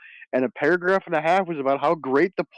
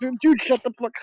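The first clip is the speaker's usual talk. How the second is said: much higher, louder disbelieving cry; exasperated outburst; 12 semitones higher, 4 dB louder than usual. Retold or imitated. Reacting to Trump's speech, high exasperated outburst. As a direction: blurt it out in comic disbelief. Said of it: "And I'm just like".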